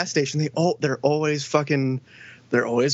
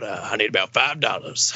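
low mock old customer; 6 semitones lower than usual. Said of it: "usually they're like".

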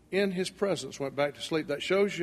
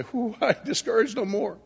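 laughing relief; 7 semitones higher than usual. Pretend. Imagined reply when roused by angel.

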